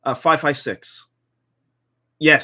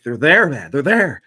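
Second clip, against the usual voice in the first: insistent; 7 dB louder, slower than usual. Retold or imitated. Insisting to Mercer on target.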